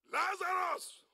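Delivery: loud, high summoning shout; calling out. Acted. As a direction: call the dead man out of his tomb. Jesus calls Lazarus from the tomb.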